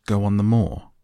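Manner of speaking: a sudden startled outburst. A narrator's reading. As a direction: blurt it out in shock. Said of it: "He moved as if he were startled".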